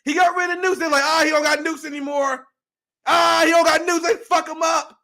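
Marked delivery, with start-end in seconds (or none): 1.0-4.9 loud mocking gloat, higher pitch. Podcaster voicing gleeful Western powers, mock gloat. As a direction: jeer like a bully who won.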